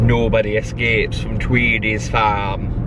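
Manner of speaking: put-on slow, low Yorkshire accent. Fan quoting Chicken Run on the drive, Yorkshire accent. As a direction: draw it out low in broad Yorkshire. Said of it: "That's my Yorkshire accent".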